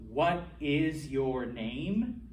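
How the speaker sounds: each word said slowly and separately; slow, spelled-out phrasing. Shown as a demonstration. Teacher slowly spelling out the English question for students. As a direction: lay out every word for the students. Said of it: "literally that sentence".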